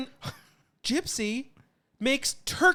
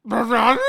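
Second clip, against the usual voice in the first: loud rising cat call; mock cat noise; 3 semitones higher, 8 dB louder, faster than usual. Cat making eye contact with her owner, loud rising call. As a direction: make it a demanding question. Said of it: "and go".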